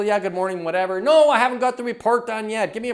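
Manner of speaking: short with coworkers, mock grumpy; grumpy snapping. Coach mimics snapping at coworkers after a bad commute.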